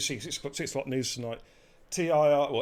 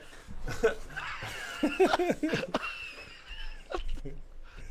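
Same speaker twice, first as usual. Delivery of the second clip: long, high wheezing laugh. Real, not acted. Host cracking up on his stream, long wheezy laugh. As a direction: laugh till it turns breathless.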